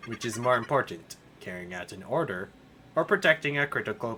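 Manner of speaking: low, soft musing AI; low hushed computer voice; calm computer voice. Acted. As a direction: speak like a puzzled machine.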